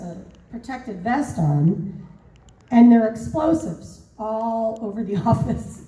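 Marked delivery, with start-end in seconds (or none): none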